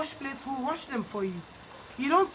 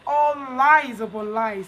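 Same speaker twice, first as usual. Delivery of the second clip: 1 slow scolding; 7 dB louder, slower than usual. Shown as a demonstration. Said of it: "teacher scolding".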